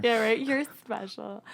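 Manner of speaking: put-on drunk girl being sweet; drunk friend voice. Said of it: "Sound like the drunk friend at the bar".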